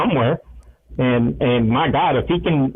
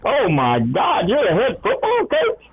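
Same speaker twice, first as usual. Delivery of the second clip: amazed; 7 semitones higher than usual. Retold or imitated. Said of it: "And he was like".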